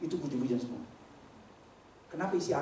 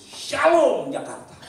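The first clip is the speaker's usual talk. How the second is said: "Shalom Jakarta" slow, high and loud; bold proclamation; 11 semitones higher, 11 dB louder, much slower than usual. Acted. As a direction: proclaim it in faith. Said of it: "saya tulis".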